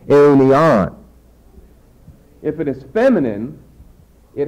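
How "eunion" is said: Eunion, loud on the closing -on; stress on last syllable. Shown as a demonstration. Teacher repeating the masculine form, stress on last syllable. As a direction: accentuate the end of Eunion. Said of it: "You raise your voice to accentuate the last syllable".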